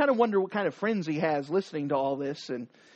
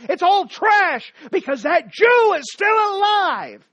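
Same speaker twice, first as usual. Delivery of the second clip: loud, high, slow hateful shout; consumed by rage; 10 semitones higher, 8 dB louder than usual. Acted. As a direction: spit out the hatred. Haman's hateful outburst.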